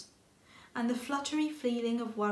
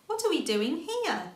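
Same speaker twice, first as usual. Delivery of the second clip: curious little rabbit; 6 semitones higher, 5 dB louder than usual. A narrator's reading. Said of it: "Little Rabbit asked".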